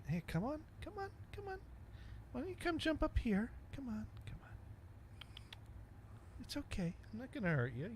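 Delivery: sweet, soft high calling to a shy cat; cat-coaxing voice. Real, not acted. Host talks high and soft so the cat comes closer. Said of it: "I'm gonna have to talk like this".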